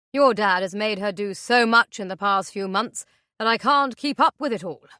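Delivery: falsely friendly man. Acted. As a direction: play the creepy jovial guest. Intruder taunts with fake cheer.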